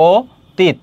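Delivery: অতীত said as ওতীত, the first অ turned to ও, very slowly; correct pronunciation, slowly. Shown as a demonstration. Teacher slowly showing students the correct sound of অতীত. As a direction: stretch অতীত into ওতীত, syllable by syllable. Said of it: "তাহলে এখানে কি হবে?".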